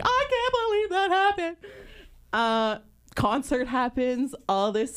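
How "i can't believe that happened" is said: high-pitched overwhelmed fan squeal; hammed-up panic attack, pitched way up; mock panic. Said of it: "fucking panic attack because I'm just like".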